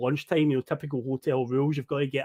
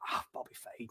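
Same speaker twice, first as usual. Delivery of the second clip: hungover whisper; 5 semitones lower, 19 dB quieter than usual. Retold or imitated. Hungover driver brushing off worry.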